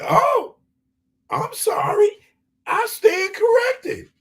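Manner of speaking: very high, squeaky mock woman's voice; mock lady voice. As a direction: mimic a flustered interviewer in falsetto. Mocking the interviewer lady.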